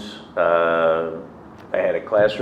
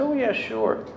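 much higher, feigned casual yes; mock eager; 4 dB quieter than usual. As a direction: act casual but eager. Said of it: "I said".

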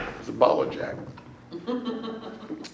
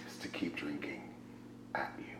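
whisper; 10 semitones lower, 22 dB quieter than usual. Real, not acted. Confessing the easy way out.

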